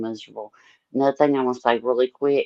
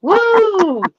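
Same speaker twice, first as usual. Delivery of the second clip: excited whoop; 9 dB louder than usual. Pretend. Joking about singing karaoke.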